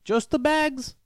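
quick high-pitched mock vendor voice; imitating the coffee guy. Vendor explaining only bags are left, high mock voice. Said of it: "He's like".

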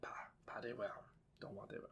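whispered fake French accent; mock French whisper. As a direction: breathe it in a jokey French voice.

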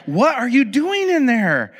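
high, drawn-out mock disbelief; acted incredulity. Parent asking daughter about the noise, incredulous. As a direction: stretch it out like a baffled parent.

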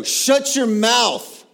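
loud, high, drawn-out command; fierce preacher's rebuke; commanding peacemaker. Acted. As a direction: shout down a demon with authority. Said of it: "Peacemakers go".

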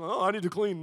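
hammed-up, silly resolve; mock eagerness. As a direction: parody a sudden urge to tidy. Mocking the unlikely thought.

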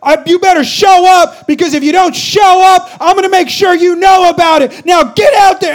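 loud, high coach's yell. Retold or imitated. Coach yelling the team onto the field, furious. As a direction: threaten them like a drill coach. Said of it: "he goes".

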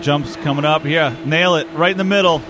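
building to a loud, high cheer; rising excitement that peaks at the jump; excited. Real, not acted. Nailing a jump in game.

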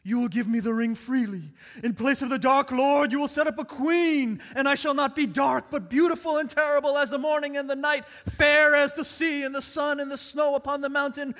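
booming elf queen, high and very loud; Cate Blanchett. Acted. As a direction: thunder it out as a terrible queen seized by power. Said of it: "my best Cate Blanchett voice here".

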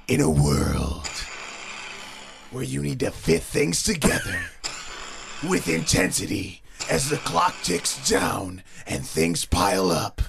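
dramatic trailer read, fighting laughter; movie trailer voice. Acted. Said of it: "Keep it going".

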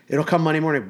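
ordinary delivery

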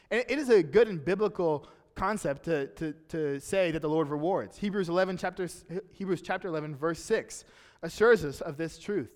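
usual speaking voice

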